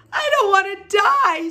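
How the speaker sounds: very high, loud panicked squeal; screamed. A narrator's reading. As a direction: scream it in full tantrum. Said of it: "screamed Wilbur".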